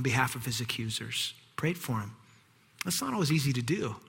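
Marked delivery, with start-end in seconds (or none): none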